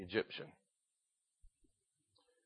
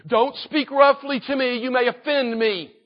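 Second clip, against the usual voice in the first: higher, louder, drawn-out objection; mock protest; 8 semitones higher, 7 dB louder than usual. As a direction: protest loudly as the touchy listener, hammed-up. Mimics easily offended modern listeners.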